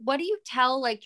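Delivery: ordinary talk, nothing marked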